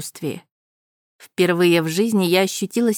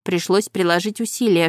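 usual speaking voice

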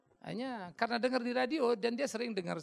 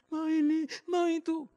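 higher whining mimic then loud scolding; whiny patient mimic; 10 semitones higher, 8 dB louder, slower than usual. Mimicking whiny sick person, scolding. (meniru orang sakit cengeng lalu membentak)

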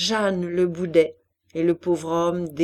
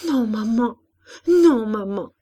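put-on tearful child pleading with mother; distressed, crying little-girl voice; sobbing stammer; 3 semitones higher, 5 dB louder than usual. A narrator's reading. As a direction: babble it through tears. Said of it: "elle éclata elle-même en sanglots".

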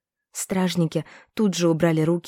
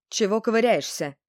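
put-on higher-pitched, curt old market seller; gruff old woman; 4 semitones higher than usual. A narrator's reading. Old seller to a browsing girl, gruff. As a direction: snap roughly at a browsing customer. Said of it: "грубовато бросила старушка".

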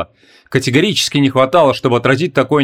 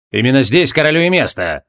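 cold. A narrator's reading. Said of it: "холодно ответила Белезна".

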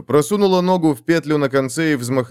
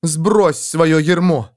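slow, forceful angry demand; 5 semitones higher, 3 dB louder, much slower than usual. Acted. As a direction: hurl it at him. Continues angry urging.

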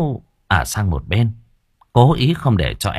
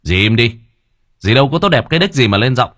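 fast, higher, forceful warning; growled warning; 4 semitones higher, 4 dB louder, faster than usual. A narrator's reading. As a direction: snap at her to put her in her place. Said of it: "Tấn gằn giọng".

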